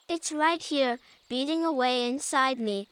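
usual speaking voice

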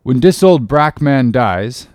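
slow, grave dialect. A narrator's reading. Cook answers about his death, slowly. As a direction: draw it out as the old cook.

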